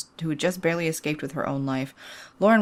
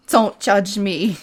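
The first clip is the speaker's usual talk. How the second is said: snapping; 4 semitones higher, 6 dB louder, much slower than usual. Acted. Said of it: "She snapped at her daughter".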